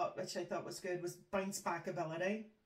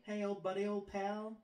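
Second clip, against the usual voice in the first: American buddy. Shown as a demonstration. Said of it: "That was quite American".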